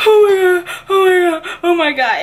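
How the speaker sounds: high, loud tearful cries; crying out. Retold or imitated. Seeing the photo of her little sister, crying loudly. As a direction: burst into tears at the picture. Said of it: "I just start crying".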